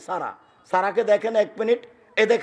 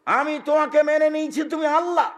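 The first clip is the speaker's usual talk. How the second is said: fervent prayer; 8 semitones higher than usual. Retold or imitated. Sarah praying in solitude, high earnest plea. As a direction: pray it out high and heartfelt, alone. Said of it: "নির্জনে আমি বলছি".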